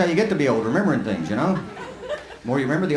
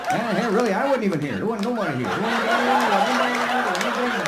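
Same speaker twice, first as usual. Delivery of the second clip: put-on higher, rambling old-timer voice; old granddad rambling; 3 semitones higher than usual. Granddad in the passenger seat reminiscing, old-timer ramble. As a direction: grumble on like a grandpa who won't stop. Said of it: "he's gonna be over on the passenger side over there going".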